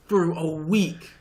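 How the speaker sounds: hammed-up slow comic payoff; slow punchline. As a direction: stretch out "for a week" and land it.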